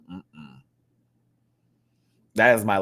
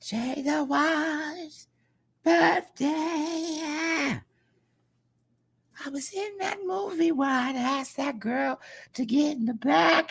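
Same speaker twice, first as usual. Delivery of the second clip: much higher, slow, drawn-out [name] voice; a hammed-up [name] singing birthday wishes; terrible [name]; slower than usual. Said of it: "That was my terrible impression of [name]".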